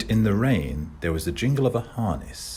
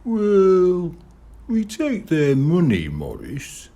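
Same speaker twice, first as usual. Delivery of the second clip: put-on young boy voice; 12 semitones higher, 6 dB louder, slower than usual. Kid voiced, anxious.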